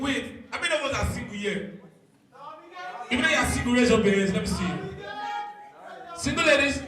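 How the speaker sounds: ordinary talk, nothing marked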